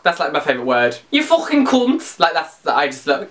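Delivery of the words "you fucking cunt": slow, higher-pitched mock Scouse; drawn-out Scouse with throaty consonants; exaggerated Scouse accent. Shown as a demonstration. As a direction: do a hammed-up Scouser insult. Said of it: "I can try and do Scouser".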